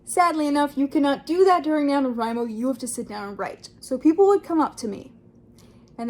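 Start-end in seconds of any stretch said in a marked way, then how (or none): none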